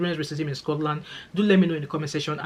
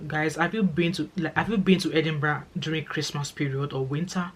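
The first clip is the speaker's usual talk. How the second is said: normal talking voice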